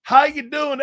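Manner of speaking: hammed-up Southern greeting, high and fast; Brian Kelly's Southern accent. Co-host mocking the coach's new accent, fake Southern twang. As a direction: imitate a put-on Southern accent. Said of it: "with that accent going".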